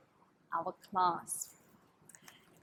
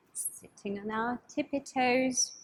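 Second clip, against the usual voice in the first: ordinary delivery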